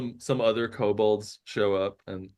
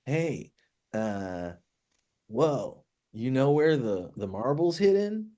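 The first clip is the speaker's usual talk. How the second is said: lightly voiced character asking around; Ragnu; 4 semitones higher than usual. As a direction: pitch climbing into a questioning lift. GM as Ragnu questioning householders.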